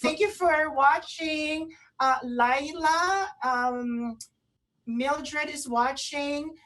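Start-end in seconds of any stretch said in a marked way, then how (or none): none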